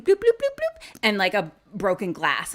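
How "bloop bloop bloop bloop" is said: high, popping bloops; bloop noises. Retold or imitated. Narrator imitating strange sounds from outside. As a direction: pop each bloop up high. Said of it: "we hear bloop".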